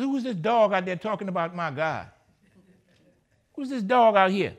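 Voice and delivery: loud, high challenge to the giant; defiant shout. Acted. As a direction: bellow it like David daring Goliath.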